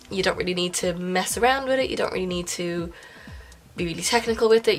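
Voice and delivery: ordinary talk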